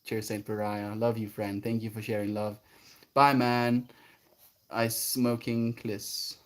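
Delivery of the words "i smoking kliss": slow broken English. Acted.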